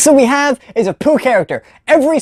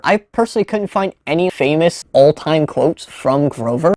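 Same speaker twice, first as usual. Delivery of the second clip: ordinary talk, nothing marked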